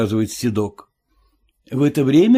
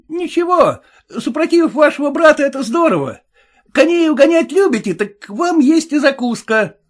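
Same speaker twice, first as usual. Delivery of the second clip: much higher, louder wary coachman; high suspicious cabbie; cab driver voice; 14 semitones higher, 5 dB louder than usual. Acted. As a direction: play the sly cabbie, pitched well up.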